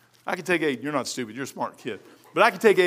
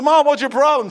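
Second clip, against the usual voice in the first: louder, higher playful scold; mock exasperation; 7 semitones higher, 8 dB louder than usual. Son teasing his mother about her pills, louder and higher. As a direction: throw it at her, half laughing.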